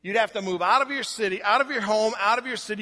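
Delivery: ordinary talk, nothing marked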